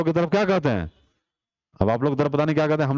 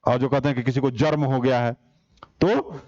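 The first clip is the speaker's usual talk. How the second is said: ordinary talk, nothing marked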